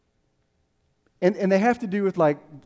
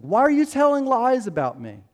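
high-pitched indignant demand; acting out David confronting his accusers; raised, accusing question; angry; 7 semitones higher, 4 dB louder than usual. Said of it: "And he's angry".

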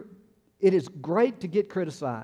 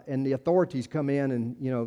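ordinary delivery

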